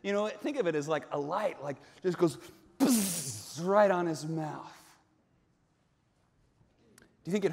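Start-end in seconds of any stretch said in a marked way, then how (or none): 2.8-3.6 imitated searing hiss; sizzling buzz. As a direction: make the sound of a coal burning skin. Imitates the coal searing lips.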